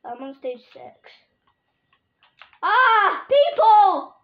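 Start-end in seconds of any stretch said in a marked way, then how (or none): 2.6-4.1 high loud cry for people; panicked shout. Real, not acted. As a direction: call out in sudden panic. Panicked calling in game.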